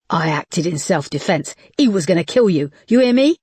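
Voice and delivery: desperate lover shouting at Nina to listen; insistent anger. Acted. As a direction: shake her and make her hear you. Max shakes Nina, insisting.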